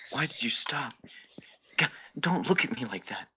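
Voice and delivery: a flustered whispered thought. Acted. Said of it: "Rin thought".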